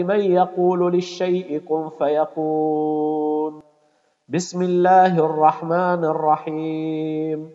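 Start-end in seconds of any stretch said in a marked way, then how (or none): none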